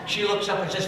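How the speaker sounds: normal talking voice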